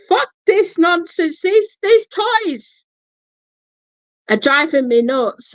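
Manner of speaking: high, exasperated outburst. Retold or imitated. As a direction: voice shooting up in frustration. Freaked out by the toys.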